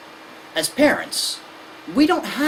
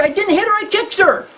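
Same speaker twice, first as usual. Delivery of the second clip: put-on cheeky kid; 14 semitones higher, 4 dB louder than usual. Kid excuse.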